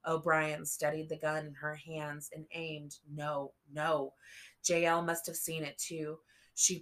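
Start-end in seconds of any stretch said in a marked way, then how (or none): none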